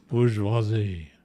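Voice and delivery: Bourgeoisie slow, boor-zhwah-ZEE, no R; drawn-out French Bourgeoisie. Shown as a demonstration. Artist carefully saying it like chat taught. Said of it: "you gotta say it like French".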